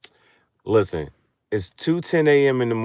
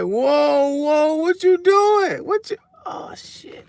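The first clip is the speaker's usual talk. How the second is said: much higher, slow startled yelps; mock startled man; 6 dB louder, slower than usual. The dude caught off guard, high startled yelps. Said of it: "the dude was like".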